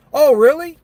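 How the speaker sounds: surprised customer. Retold or imitated. Delivery driver voicing a customer surprised to hear the news. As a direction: play the customer caught off guard. Customer reacts to Grubhub tip.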